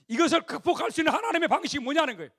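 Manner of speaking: fiery preaching. Acted. Preacher declaring God's way to Israel, fiery. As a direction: drive the point home to the crowd.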